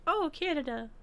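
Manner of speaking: a bright, lifted Oh, Canada from a passerby; impressed Oh Canada. Retold or imitated. Mock impressed stranger hearing she is Canadian. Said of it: "I just wanted people to be like".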